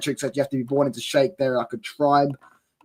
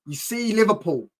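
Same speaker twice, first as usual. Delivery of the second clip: usual speaking voice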